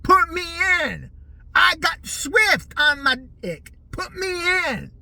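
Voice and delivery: hammed-up furious yelling player; mock screaming. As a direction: roar it at the old coach like a maniac. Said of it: "he was probably screaming that to the guy".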